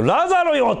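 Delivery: high, loud summons of a name; loud call. Retold or imitated. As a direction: call the name out across the tomb. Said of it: "って言ったのも大事だよね". (大声で名前を呼ぶ)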